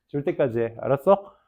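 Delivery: normal talking voice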